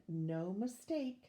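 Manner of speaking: ordinary delivery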